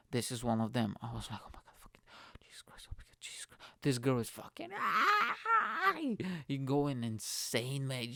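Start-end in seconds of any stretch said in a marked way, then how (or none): 1.4-3.6 frantic, fast whispered prayer; barely audible panicky whisper; whispered praying. Retold or imitated. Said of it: "where I genuinely prayed". 4.8-5.9 high-pitched imitated scream; long falsetto mock shriek. Girl screaming on the ride.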